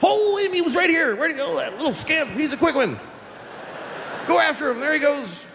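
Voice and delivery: high, bright pretend search for the dog; fake cheerful parent. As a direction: play along like the dog just ran off. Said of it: "You can't just keep going".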